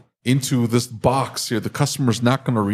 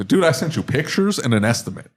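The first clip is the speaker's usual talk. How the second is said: ordinary talk, nothing marked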